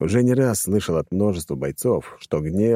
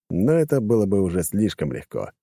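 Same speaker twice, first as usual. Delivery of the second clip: ordinary delivery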